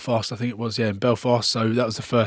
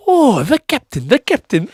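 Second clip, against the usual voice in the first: much higher, louder, slow delighted repeat; excited policemen; 12 semitones higher, 5 dB louder than usual. Retold or imitated. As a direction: gush over meeting the captain. Fijian policemen greet the captain.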